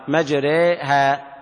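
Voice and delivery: مجريها half-sung very slowly, ending on e; مجريها بإمالة ملحنة; drilled imala. Shown as a demonstration. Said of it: "اعملها ميزان، اتدرب عليها".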